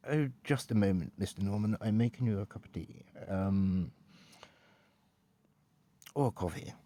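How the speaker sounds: posh English butler; unhurried, courteous posh English service; Grim. Pretend. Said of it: "particularly posh English".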